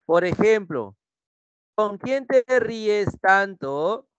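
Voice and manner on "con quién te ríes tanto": high, drawn-out interrogation; toxic partner. Acted. Toxic partner questions who you laugh with, accusing tone. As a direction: stretch the question out suspiciously. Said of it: "Te dice tu tóxico o tu tóxica".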